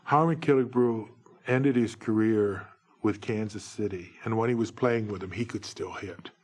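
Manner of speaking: ordinary delivery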